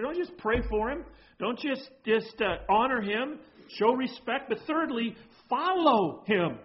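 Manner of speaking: preaching. Acted. Pastor charging the congregation to follow, preaching. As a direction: build the charge to follow him.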